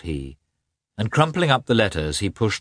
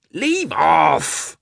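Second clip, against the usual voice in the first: high, loud irritated cry; angry; 10 semitones higher, 5 dB louder than usual. A narrator's reading. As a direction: protest angrily as the blanket is pulled away. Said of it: "he said angrily to Yashvin".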